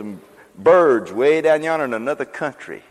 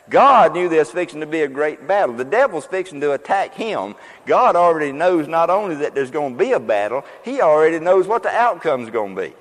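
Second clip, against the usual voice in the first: rising, louder preaching; preaching build; 4 semitones higher, 4 dB louder than usual. Acted. Preacher driving home God's victory to the congregation. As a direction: build the fire toward the punchline.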